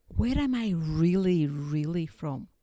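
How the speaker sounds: slow, stretched-out prying question; mock nosy stranger, very slow; probing question. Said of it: "all they really want to know".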